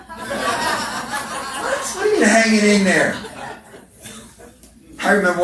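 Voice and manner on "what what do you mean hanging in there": high, laughing disbelief; laughing what do you mean; incredulous. Acted. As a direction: throw it back in mock disbelief. Incredulous retort to cliché.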